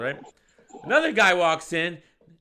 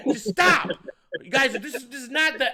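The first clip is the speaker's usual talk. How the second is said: high, raised-voice pleading; retold exasperated yell; 9 semitones higher, 4 dB louder than usual. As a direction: re-enact butting into a squabble. Retells breaking up the quarrel.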